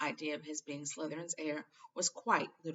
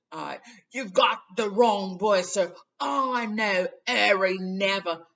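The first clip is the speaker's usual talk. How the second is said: loud, higher, agitated rant; 4 semitones higher, 14 dB louder, slower than usual. A narrator's reading. Hagrid rants to Dumbledore in loud agitation. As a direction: rant it out, waving your arms. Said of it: "Hagrid went ranting on".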